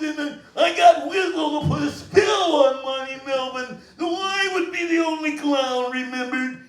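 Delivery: a clown bragging that he is king of clowns; Cookie the Clown impression. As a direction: play the clown gloating, hitting each never. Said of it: "That's my imitation of him".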